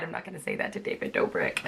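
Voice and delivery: breathy whisper, voice gone; whispered aside. Real, not acted. Telling viewers what she kept to herself, in a whisper.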